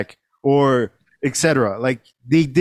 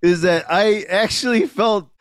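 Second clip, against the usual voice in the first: normal talking voice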